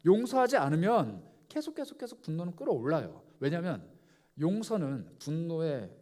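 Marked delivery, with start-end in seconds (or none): none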